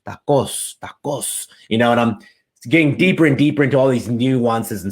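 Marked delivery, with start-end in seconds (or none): 0.1-1.5 "tacos" with a crisp Spanish t and pure vowels, /takos/; "tacos" pronounced as in Spanish, not English; Spanish pronunciation. Shown as a demonstration. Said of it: "he doesn't say tacos".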